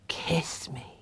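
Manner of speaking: hushed, pleading whisper. A narrator's reading. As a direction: breathe the words softly. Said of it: "in a faraway voice whispered".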